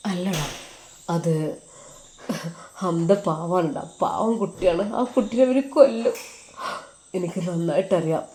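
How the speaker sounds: quietly choked up, a touch of crying; tearful. Acted. Ajmal near tears telling his friend about Hamda, soft and tearful. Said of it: "നീ ഇങ്ങനെ കരയുന്നത്?".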